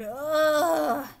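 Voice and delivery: a worn-out chicken groan; tired groan. Acted. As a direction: groan like you're exhausted. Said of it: "The exhausted chickens groaned".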